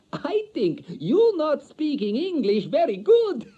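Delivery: high, slow, loud mischievous voice; wife mocking his English; teasing foreign wife. A narrator's reading. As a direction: tease him playfully.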